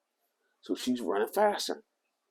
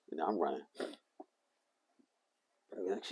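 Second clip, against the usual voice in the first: ordinary talk, nothing marked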